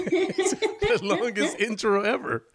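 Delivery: cracking up while talking; laughing. Real, not acted. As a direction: laugh your way through the remark. Laughing at the long intro.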